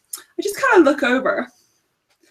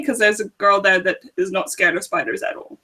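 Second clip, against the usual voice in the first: ordinary talk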